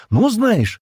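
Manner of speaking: high, loud outraged retort; bursting with indignation. A narrator's reading. Джемма mocked by Стэн, fit to burst with indignation. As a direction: blurt it out in outrage. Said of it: "от негодования готова была лопнуть".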